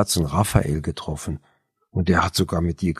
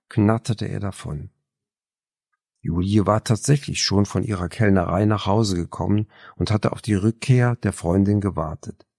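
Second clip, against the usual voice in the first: ordinary delivery, nothing marked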